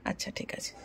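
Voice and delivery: whispered aside. Real, not acted. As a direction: drop to a whisper for a moment. Aside while daughter speaks shyly. (ফিসফিস করে)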